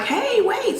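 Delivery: calling out. Retold or imitated. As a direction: shout after the leaving car. Car pulls off without her.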